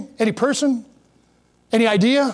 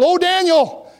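a high, mournful cry to Daniel; lamenting cry; 9 semitones higher, slower than usual. A narrator's reading. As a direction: call out to Daniel in grief. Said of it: "you can hear the cry in his voice".

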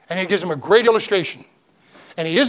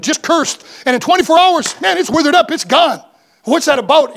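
raised, higher preacher's cry, building; preaching at full force; 6 semitones higher than usual. Acted. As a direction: lift the congregation with rising fervour. Dramatic retelling of the withered fig tree.

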